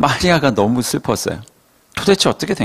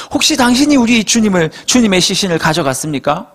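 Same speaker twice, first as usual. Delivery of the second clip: anxious plea; 6 semitones higher than usual. Acted. Mary pleads with gardener.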